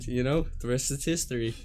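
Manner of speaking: ordinary delivery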